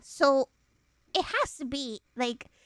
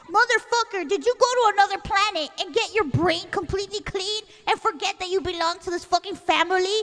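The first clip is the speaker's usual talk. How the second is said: loud, raised-pitch retold tirade; incredulous ranting; 5 semitones higher, 4 dB louder than usual. Sibling to sister who forgot family habits, incredulous rant. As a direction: rant on and on, building to a higher pitch.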